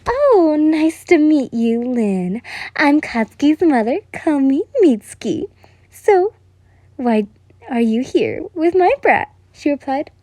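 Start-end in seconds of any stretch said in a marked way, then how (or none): 0.1-5.5 high, loud and friendly; mother suddenly nice to the guest; sweeter voice. A narrator's reading. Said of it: "with a lot nicer sounding voice". 5.9-9.3 lightly voiced mother. A narrator's reading. Mother questions the guest.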